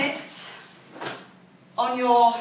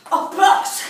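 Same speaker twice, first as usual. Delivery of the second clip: higher, louder call to go; loud start call; 5 semitones higher, 6 dB louder than usual. Real, not acted. Coach calling the class off the blocks, loud and bright. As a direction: call it out to launch them.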